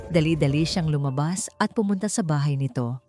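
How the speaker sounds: ordinary delivery, nothing marked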